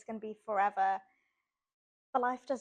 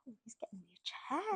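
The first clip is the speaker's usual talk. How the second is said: whispered aside; 6 dB quieter than usual. Retold or imitated. Retold private thought, charmed.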